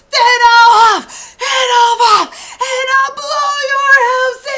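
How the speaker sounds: high, loud hammed-up shout; big playful wolf bellow. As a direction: yell the threat at the house. Wolf threatens second pig.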